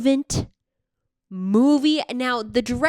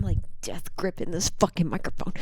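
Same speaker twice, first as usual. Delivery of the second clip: ordinary talk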